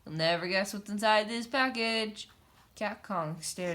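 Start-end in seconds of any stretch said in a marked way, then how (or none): 0.0-2.3 shouting tease. A narrator's reading.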